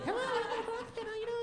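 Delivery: tiny, very high greeter voice; a lapdog yapping hello; yappy Yorkie voice. Retold or imitated. Gym receptionist greeting members, very high and yappy. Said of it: "sounds like a Yorkie greeting you".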